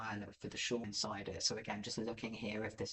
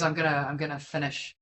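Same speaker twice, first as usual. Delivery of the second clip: ordinary delivery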